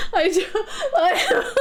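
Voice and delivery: normal talking voice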